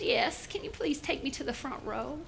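put-on soft, small old-lady voice; hushed elderly request; little old lady. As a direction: ask politely as a frail old woman. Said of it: "little old lady".

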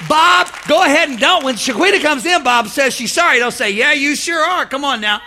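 loud, high, driving sermon; full-force preaching. Real, not acted.

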